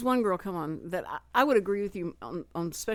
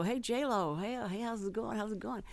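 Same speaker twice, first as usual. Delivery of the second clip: chatty, overfamiliar; 3 semitones higher, 4 dB quieter than usual. Retold or imitated. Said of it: "she just keeps talking".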